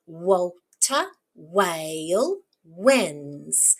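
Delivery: very slow, each word separated and stretched; alliteration demo. Shown as a demonstration. Teacher shows repeated first sound, words slow and apart. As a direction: space out "Walter Whale wins", leaning on each opening sound. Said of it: "what that first sound is".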